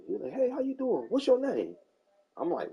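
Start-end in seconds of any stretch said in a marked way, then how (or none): 0.3-1.8 put-on high, prying old geezer voice; nosy old neighbor. The old neighbor questioning him at his truck, high prying voice. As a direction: play the nosy old man, pitched up.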